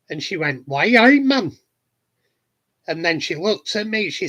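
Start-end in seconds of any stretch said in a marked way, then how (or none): 0.7-1.6 high, loud mock Geordie; a lightly put-on Geordie, higher and louder; Geordie accent. Dancer answers the mate's request in Geordie accent. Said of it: "talk Geordie to me".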